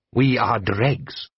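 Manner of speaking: slow, solemn and even; Jabbar's slow saying. A narrator's reading. Jabbar to Blue Eyes, slow and solemn. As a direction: say it like a proverb.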